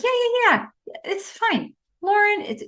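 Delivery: high-pitched, hurried reassurance; impatient brush-off. Retold or imitated. Felicity impatiently brushes him off.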